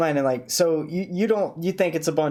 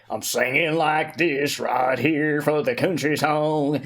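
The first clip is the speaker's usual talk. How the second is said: put-on slow, old-man country singer voice; slower than usual. Shown as a demonstration. Showing friends the voice from his old song, slow old country singer. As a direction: sing it like an old country crooner. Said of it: "I put on an old voice".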